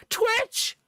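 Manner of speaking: very high, sudden squeal. Acted. Streamer acting out the box's twitch, sudden squeal. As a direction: jolt up into a squeal on the word.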